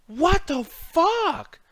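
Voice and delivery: very high, slow incredulous cry; real outrage at a baffling result; stunned disbelief. Real, not acted. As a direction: drag it out in disbelief. Disbelief at Reigns winning.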